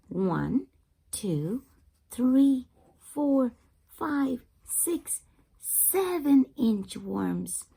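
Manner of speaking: usual speaking voice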